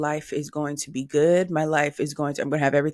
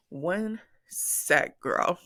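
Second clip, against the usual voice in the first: normal talking voice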